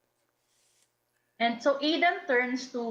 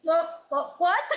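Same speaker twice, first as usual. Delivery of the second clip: confused what; 7 semitones higher than usual. Acted. Diana confused by question.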